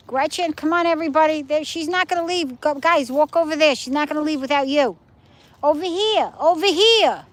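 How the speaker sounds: high, loud calls to the dogs; calling out to the dog. Real, not acted. Owner calling her dogs on the walk, high and loud. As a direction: call the dogs over across the sand.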